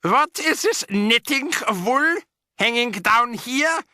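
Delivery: high, slow, sharp witch voice; witch snapping. A narrator's reading. Grand High Witch demanding who calls, sharp witch voice. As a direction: snap it out like a haughty witch.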